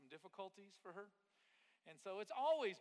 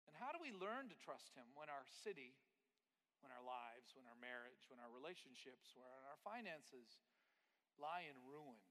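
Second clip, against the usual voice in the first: preaching; 2 semitones lower, 3 dB quieter than usual. Acted. Sermon build.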